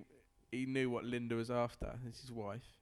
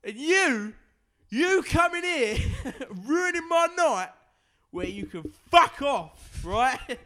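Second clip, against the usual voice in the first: much higher and louder, slow, laughing through the rant; ranting pub lout; 10 semitones higher, 11 dB louder, slower than usual. Acted. Drunk lout ranting at a stranger in his pub, loud laughing rant. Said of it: "Should be an actor, [name]".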